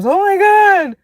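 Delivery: high gushing fake friend; mock gushing. Mocking a fake friend who gushes at her. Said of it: "people that think I'm".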